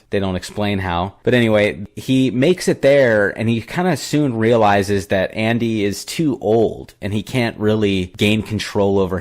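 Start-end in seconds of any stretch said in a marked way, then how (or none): none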